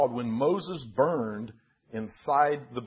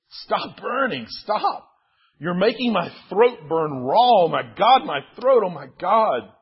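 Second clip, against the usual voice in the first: loud, high plea in pain; desperate, pitched-up begging; anguished outcry; 4 semitones higher, 8 dB louder than usual. Acted. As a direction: cry out as if your throat were burning. Moses cries out as he burns.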